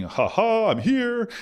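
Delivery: high, gloating "I've arrived" voice; mock his younger self's triumphant arrogance; smug self-mockery. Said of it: "And I'm thinking".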